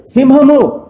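high, loud shout of "సింహము"; loud cry. Retold or imitated. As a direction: shout the word like a lookout seeing danger. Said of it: "పెద్దగా అరుస్తున్నాడు". (పెద్దగా అరిచే కేక)

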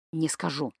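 quiet, firm refusal. A narrator's reading. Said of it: "твёрдо стоял на своём".

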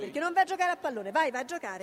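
alza la voce, stufa, più acuta; urlo esasperato. Acted. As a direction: cacciarlo via gridando. Madre esasperata caccia il figlio. (madre esasperata che grida al figlio di andare a giocare)

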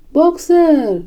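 high, calling scream of a name; desperate cry. A narrator's reading. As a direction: call after someone being taken away. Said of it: "țipă ea". (strigăt disperat, pe ton înalt)

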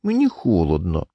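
pouting, feeble wife's whine; capricious complaint. A narrator's reading. Wife pouts at her husband about cold, capriciously. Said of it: "знову капризно скривила губки".

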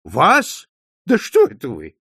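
loud, astonished questioning; exclaiming. A narrator's reading. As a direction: question him in disbelief. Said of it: "воскликнул Скалли". (громко, изумлённо)

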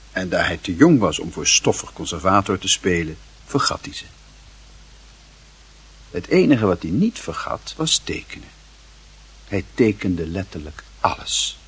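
ordinary talk, nothing marked

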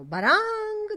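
loud, high bell clang; ringing vocal clang; clanging bell imitation. A narrator's reading. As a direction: imitate a handbell ringing out loudly. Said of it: "L'imbonitore suonava il campanello".

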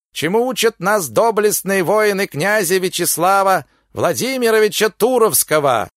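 high, loud fairground crier; loud carnival barker. Acted. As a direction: bellow it to the whole crowd. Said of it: "заорал голосом балаганного зазывалы".

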